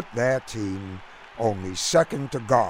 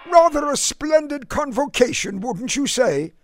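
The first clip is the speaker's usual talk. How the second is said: put-on high, lofty English gent's accent; lofty British accent; 13 semitones higher, 4 dB louder than usual. A narrator's reading. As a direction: make genteel small talk in a clipped British accent. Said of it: "in his lofty, clipped accent".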